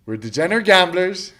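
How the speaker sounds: chuckling through the words; laughing. Real, not acted.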